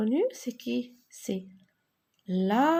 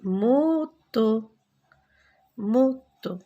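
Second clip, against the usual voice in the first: loud model word; 6 dB louder, much slower than usual. Shown as a demonstration. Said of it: "j'ai formé des mots".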